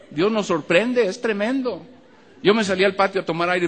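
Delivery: high, loud preaching; fervent preaching. Acted. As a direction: proclaim it with fire. Preaching at full force. (predicación fervorosa, aguda y fuerte)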